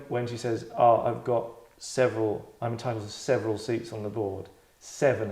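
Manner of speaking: ordinary delivery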